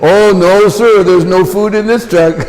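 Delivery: mock innocence. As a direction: play it straight-faced to the guard. Innocent lie to prison guard.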